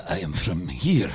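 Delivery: a low-voiced Gaza local answering the tourist; grim local man. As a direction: play the local, deadpan and dark. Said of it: "And somebody will go".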